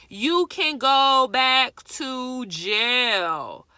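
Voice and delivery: loud, very slow, drawn-out scolding; real emphatic warning. Real, not acted. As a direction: spell it out loudly, stretching every word. Repeats the jail warning slowly.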